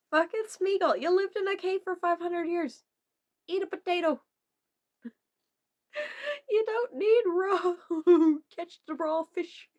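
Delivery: high, loud mock rant; mock scolding. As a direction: scold Smeagol playfully. Scolding Smeagol.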